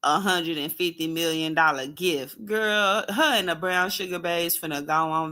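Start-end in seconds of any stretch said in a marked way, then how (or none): none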